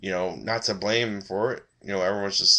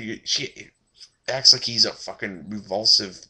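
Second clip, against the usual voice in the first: ordinary talk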